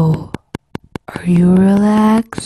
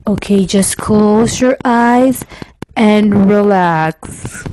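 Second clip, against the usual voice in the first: lower, slow, intimate ASMR murmur; 3 semitones lower, much slower than usual. Pretend. Vlogger demonstrating ASMR to viewers, lower slow intimate voice. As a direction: lull the listener to close their eyes.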